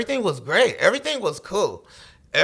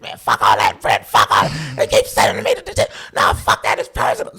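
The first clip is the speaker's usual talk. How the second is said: angry outburst; 4 semitones lower, 4 dB louder than usual. Retold or imitated. Boo angry about detention.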